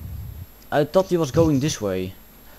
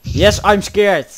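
loud, drawn-out cry of fear; scared yell; 5 semitones higher, 8 dB louder, slower than usual. Real, not acted. Hiding player admits being scared, scared yell. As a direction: shout it higher and slower.

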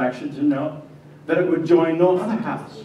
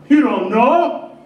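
loud, high, angry pressing; heated disbelief; 10 semitones higher, 7 dB louder than usual. Acted. Joseph confronting Mary over her answer, angry. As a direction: demand it, half beside yourself.

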